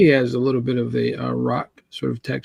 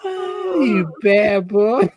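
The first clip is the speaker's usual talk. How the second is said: campy scold; 7 semitones higher, much slower than usual. Pretend. Acting campy comic characters.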